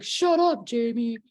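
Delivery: high, loud mock protest; brother's retort. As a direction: imitate a teased brother telling his sister off. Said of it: "And he's like".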